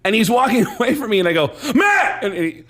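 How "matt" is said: very high shout of a name; calling out. Retold or imitated. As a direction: call him back across the lot. Calling him back.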